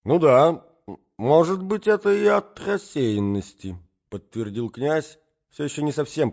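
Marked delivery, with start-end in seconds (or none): 0.0-3.8 put-on high, slow, bewildered old man; an addled old nobleman, high and unsure; doddering old prince. A narrator's reading. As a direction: mumble it like a confused old prince. Old prince voiced in story.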